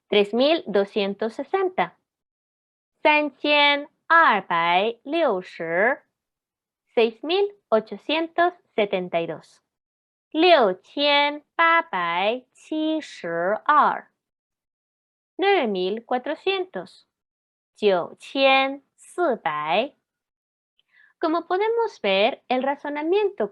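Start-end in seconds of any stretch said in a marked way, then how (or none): 3.0-5.9 slow, syllable-by-syllable Mandarin numbers; Mandarin pronunciation demo. Shown as a demonstration. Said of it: "Veamos estos ejemplos". 10.3-14.0 slow, syllable-by-syllable Mandarin numbers; Mandarin pronunciation demo. Shown as a demonstration. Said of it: "Veamos estos ejemplos". 17.8-19.9 slow, syllable-by-syllable Mandarin numbers; Mandarin pronunciation demo. Shown as a demonstration. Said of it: "Veamos estos ejemplos".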